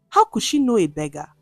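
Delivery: ordinary delivery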